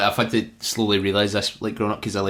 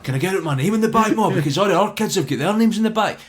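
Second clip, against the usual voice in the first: put-on high-pitched kid begging mum; eager high-pitched child's request; pleading child; 11 semitones higher than usual. Acting childhood self asking mum.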